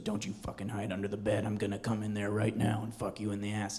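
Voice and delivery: a hint of the creepy whisper; creepy caller voice. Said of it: "and he goes".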